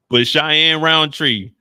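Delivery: slow, loud, drawn-out name call. Real, not acted. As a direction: stretch the name out loudly. Calling her name out to listeners.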